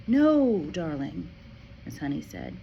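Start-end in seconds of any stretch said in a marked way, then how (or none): 0.1-1.3 reassuring teacher. Acted.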